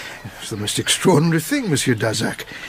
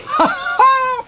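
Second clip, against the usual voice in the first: sudden high delighted laugh; 4 dB louder than usual. Acted. Inspector laughing as he reveals the footprints. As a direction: burst out laughing, pleased.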